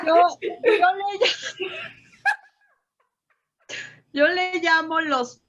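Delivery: talking through a high-pitched laugh; words breaking up with real laughter. Real, not acted. Laughing after the joke.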